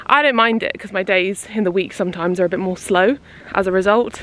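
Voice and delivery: usual speaking voice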